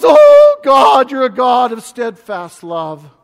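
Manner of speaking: loud, high cry of praise. Retold or imitated. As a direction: shout it out in awe. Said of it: "And he goes".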